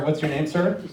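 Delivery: normal talking voice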